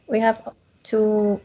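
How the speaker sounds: ordinary talk, nothing marked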